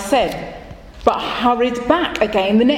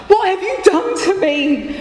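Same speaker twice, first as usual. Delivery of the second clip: high, pleading cry of a desperate man; a poor man begging the rabbi to undo it; poor man confronting the rabbi, high and desperate; 10 semitones higher than usual. Acted. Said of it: "he said".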